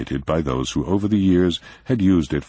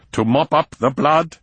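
snapped; 12 semitones higher, 5 dB louder than usual. A narrator's reading. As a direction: bite the words out. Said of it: "snapped Prinzel".

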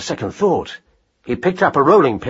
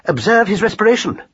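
raised, precise, mildly excited voice; 8 semitones higher than usual. A narrator's reading. Learned husband showing wife he lives, mildly excited. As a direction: point it out with pedantic excitement. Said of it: "said Marcus excitedly".

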